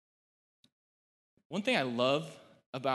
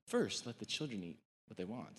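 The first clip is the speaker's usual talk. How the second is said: sarcastic; 3 semitones lower, 8 dB quieter than usual. Retold or imitated. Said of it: "saying it with a smirk".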